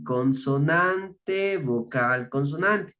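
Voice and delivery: consonante stretched out very slowly, then vocal, consonante; slow, spaced-out pattern. Shown as a demonstration. Teacher laying out the spelling rule for adjectives, slow and spaced out. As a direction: draw out CONSONANTE, separate each term.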